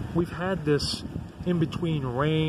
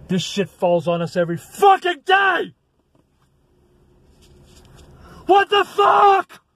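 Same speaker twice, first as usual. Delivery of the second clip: shouting, much higher and louder; furious rant; 6 dB louder than usual. Real, not acted. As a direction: yell it at the viewers in real rage. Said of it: "I kind of raged out".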